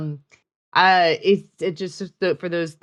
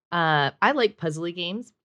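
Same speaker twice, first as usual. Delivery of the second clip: usual speaking voice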